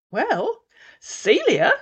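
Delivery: ordinary delivery, nothing marked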